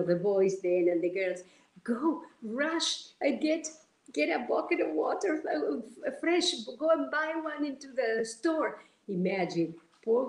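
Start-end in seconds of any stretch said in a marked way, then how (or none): 1.8-8.8 imitating calling out orders to fetch water; urgent orders. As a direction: hurry them off for a bucket. Urging helpers to fetch bucket.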